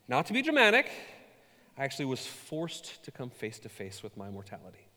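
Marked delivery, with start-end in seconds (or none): 0.1-0.9 high, overly dramatic "Not to be dramatic". Shown as a demonstration. Preacher hamming up her scary week, dramatic. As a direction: ham it up, pitched high and theatrical. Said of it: "I'm gonna be dramatic".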